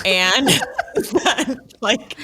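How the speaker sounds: laughing through the words, high and breaking; can't hold back a laugh while telling it. Real, not acted. Friend laughing while retelling the movie, laughing.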